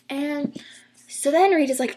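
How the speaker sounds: usual speaking voice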